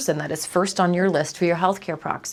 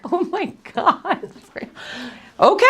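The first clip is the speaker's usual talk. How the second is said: stunned; 12 semitones higher than usual. Retold or imitated. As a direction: let it fall out in amazed disbelief. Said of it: "So I am just like".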